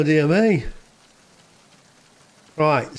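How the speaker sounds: ordinary delivery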